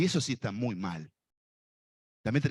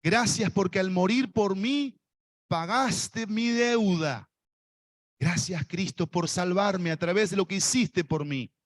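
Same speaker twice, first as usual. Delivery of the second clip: led prayer; 6 semitones higher than usual. Shown as a demonstration. Preacher leading the congregation in prayer, fervent. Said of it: "Decile, por favor".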